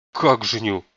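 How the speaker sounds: indignant, startled question; taken aback and affronted. A narrator's reading. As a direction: balk in disbelief at the news. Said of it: "искренне возмутился Дживон".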